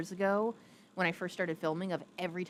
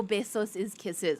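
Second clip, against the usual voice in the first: ordinary delivery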